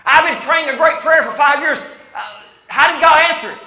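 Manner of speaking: loud, high acted complaint; exasperated testimony. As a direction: preach it as a frustrated believer. Acting a frustrated believer's testimony.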